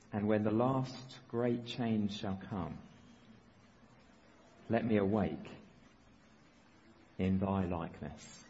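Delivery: put-on reading an old prayer slowly and solemnly. Shown as a demonstration. Preacher to congregation, reading an old prayer slowly. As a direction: let each line of the prayer land, slow. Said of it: "let me read it slowly".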